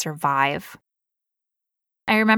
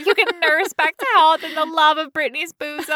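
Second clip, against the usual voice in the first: giggling through the words; laughing; 9 semitones higher than usual. Real, not acted. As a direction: talk while cracking up.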